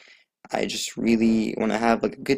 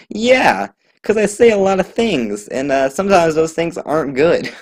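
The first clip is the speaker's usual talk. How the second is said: excited; 7 semitones higher, 9 dB louder than usual. Real, not acted. Excited confession to family worry.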